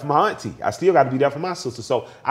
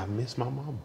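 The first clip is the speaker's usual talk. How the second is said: quiet lament; 12 dB quieter than usual. Retold or imitated. Repeats grandmother's lament when asked.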